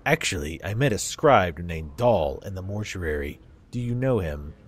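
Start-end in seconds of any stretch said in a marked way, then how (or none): none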